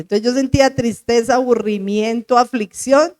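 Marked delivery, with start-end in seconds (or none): none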